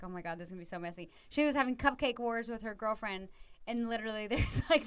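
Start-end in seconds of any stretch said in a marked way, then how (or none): 0.0-1.1 fast, softer under-the-breath oh my God; quick dismayed aside about a messy kitchen; muttered dismay. Retold or imitated. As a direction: rush it out low, dreading the mess. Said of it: "but I was just like".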